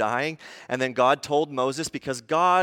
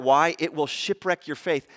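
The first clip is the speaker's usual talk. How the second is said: normal talking voice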